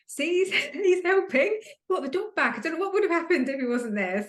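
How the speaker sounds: high, laughing delight; excited laughing. Retold or imitated. Telling Mum that Dad brought the dog back, high and laughing. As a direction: squeal it out, thrilled and laughing.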